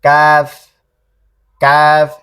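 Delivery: কাফ light, a little forward of qaf, repeated; Arabic kaf demo. Shown as a demonstration. Teacher contrasts kaf with qaf for students, light and clear. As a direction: say কাফ lightly with the tongue just ahead of the root. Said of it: "পেছনে কিন্তু হালকা".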